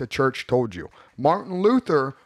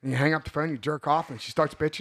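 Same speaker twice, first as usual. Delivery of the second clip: ordinary talk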